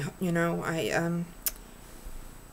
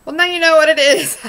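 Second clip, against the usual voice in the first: loud, high burst of self-mockery; loud exasperated laugh-cry at her own mistake; exasperated outburst; 9 semitones higher, 14 dB louder, faster than usual. Real, not acted. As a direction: blurt it out after fumbling the words. Flubbed line, exasperated at herself.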